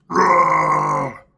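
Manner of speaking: loud, sustained monster roar; Hulk roar. Retold or imitated. Man imitating himself as Hulk in his dream, roaring. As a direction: roar full out like a raging beast. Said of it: "in this dream, I'm the Incredible Hulk".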